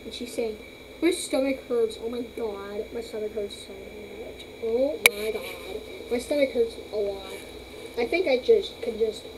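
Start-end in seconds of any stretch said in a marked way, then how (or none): 1.0-7.3 voicing the pet. Voicing pet's complaint.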